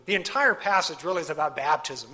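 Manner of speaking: usual speaking voice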